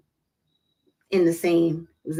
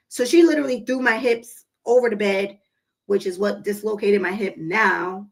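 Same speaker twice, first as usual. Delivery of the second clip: usual speaking voice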